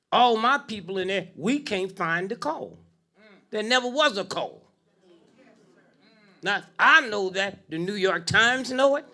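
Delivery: loud, rising sermon build; fiery preaching. Acted. Preaching at full force.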